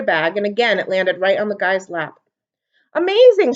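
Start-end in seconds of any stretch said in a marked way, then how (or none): 2.9-3.5 high, loud cheer; loud high amazed shout. A narrator's reading. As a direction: cheer the great throw. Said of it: "shouted someone else".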